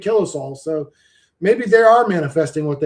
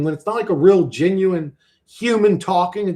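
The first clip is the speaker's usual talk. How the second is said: ordinary talk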